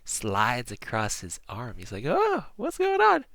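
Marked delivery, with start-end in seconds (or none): 2.1-3.2 high, drawn-out startled cry; baffled, high-pitched surprise. Acted. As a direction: react as a prisoner caught off guard. Startled prisoner reacts to mysterious note.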